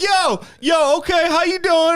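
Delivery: hammed-up yelling hello; mock shouted greeting. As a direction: holler at the yacht couple to get their attention. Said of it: "he's like".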